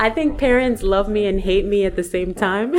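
real laughter through the words; laughing. Real, not acted. Author tells host how parents feel about her, laughing.

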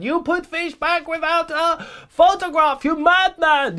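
very high, loud mock-Dutch outburst; mock Dutch accent. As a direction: put on a bad Dutch accent, shrieking in disbelief. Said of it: "excuse the Dutch, I don't know what that accent is".

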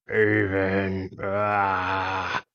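drawn-out dragon wail; angry dragon. Acted. Upset dragon cries out to Avon, angry wail. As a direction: hold the name and let it become a roar.

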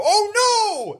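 very high, drawn-out yell; delighted yell. Retold or imitated. Telling of his outburst in the movie theater, joyful yell. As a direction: yell it out in sheer joy. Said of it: "I yelled".

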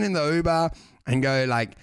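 normal talking voice

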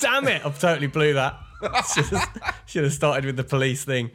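laughing through the words. Real, not acted. He laughs at telling his story backwards, laughing. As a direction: chuckle at your own botched story.

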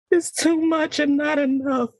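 stage monologue, raw and emotional; dramatic monologue. Acted. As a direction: play a hurt daughter talking to Mama. Performed acting monologue on mic.

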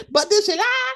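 hammed-up [name] asking it; [name] impression. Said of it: "Sound like [name]".

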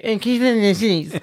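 high, childish mimicry of a request; mocking parroting. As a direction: parrot it back in a silly high voice to wind them up. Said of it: "And then when I get".

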